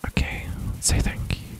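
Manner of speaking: soft breathy whisper into the mic; ASMR whisper. Shown as a demonstration. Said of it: "I'm doing an A S M R thing".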